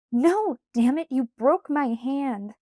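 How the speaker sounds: loud, high, slow furious complaint; angry outburst. A narrator's reading. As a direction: snap at him in pain and rage. Angry after breaking her hand.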